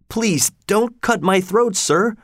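put-on high, slow, frightened child's plea; a small boy pleading for his life; begging in terror. Pip begging the convict not to hurt him, high and terrified. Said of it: "I begged in terror".

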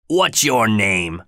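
slow, gruff-voiced demand; a rough man questioning a child, slowly; convict. Convict interrogating Pip, slow and menacing. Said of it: "said the man".

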